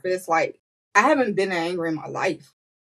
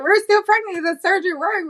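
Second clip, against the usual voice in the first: much higher, joyous shout; excited yelling; 12 semitones higher than usual. Retold or imitated. Staff cheer to the waking mother, excited yelling. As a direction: cry it out in delight. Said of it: "yelling and excited".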